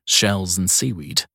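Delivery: plain reading voice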